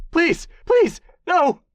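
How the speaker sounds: shrieked pleas, very high and loud; begging for his life at full voice; screaming please; terrified screaming. Acted. Captive screaming when gag removed.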